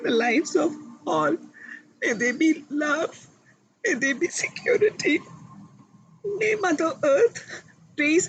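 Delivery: emotional prayer. Real, not acted. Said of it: "I got so emotional".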